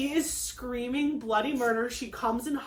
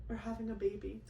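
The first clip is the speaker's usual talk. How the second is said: soft tender reveal; 12 dB quieter than usual. Real, not acted.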